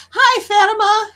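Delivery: high, loud sing-song hello; a high, loud welcome to Fatima; excited greeting. Real, not acted. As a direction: call out "Hi, Fatima" brightly. Greeting viewer on live.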